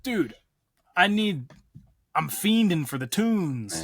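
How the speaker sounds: high, slow pleading whine; desperate plea. Retold or imitated. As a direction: beg dramatically for music. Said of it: "I was like".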